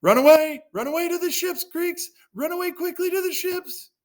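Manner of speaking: very high mock cry of alarm; panicked calling. Frightened Agamemnon calls a retreat, very high panicked cry. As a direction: squeal the retreat in a hammed-up panic. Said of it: "Agamemnon called the Greeks".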